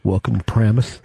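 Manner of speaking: low put-on strange-guy voice. Host playing a local greeting the visitor, low strange-guy voice. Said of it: "who'll be going like this".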